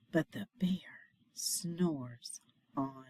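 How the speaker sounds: hushed whispered refrain. A narrator's reading. Reader hushing listeners near the sleeping bear, whispered. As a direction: whisper so the bear stays asleep.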